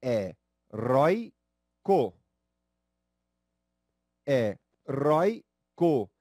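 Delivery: "Heróico" drawn out very slowly, stress on rói; slow syllable stress. Shown as a demonstration. Teacher slowly spells out the stressed syllable for the class. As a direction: stretch each syllable of "Heróico", lean on rói. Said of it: "Vamos à palavra heróico".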